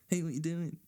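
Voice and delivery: high whiny mock texter; mock needy texter. Comedian mocking friends who text him, high whiny voice. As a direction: ham up a clingy friend texting.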